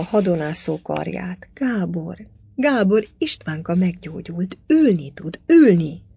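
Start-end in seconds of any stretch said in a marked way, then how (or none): 1.5-6.1 excited exclamations growing louder; urgent joy. A narrator's reading. Wife telling her husband their son can sit, excitedly. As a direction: cry out the good news.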